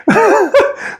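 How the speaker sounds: real high-pitched burst of laughter. Real, not acted. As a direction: crack up at the clip. Reacts to Canadian sound clip.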